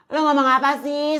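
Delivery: ordinary talk, nothing marked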